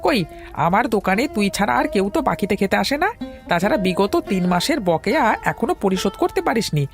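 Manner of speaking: high-pitched, worked-up tea-seller rant; indignant scolding. Acted. Tea seller berating a customer over unpaid dues, indignant.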